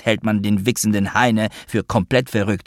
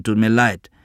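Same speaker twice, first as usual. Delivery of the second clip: ordinary delivery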